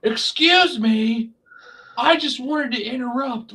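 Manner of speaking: high, whiny mock interruption; mocking Sami. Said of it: "Guess who's coming out to say".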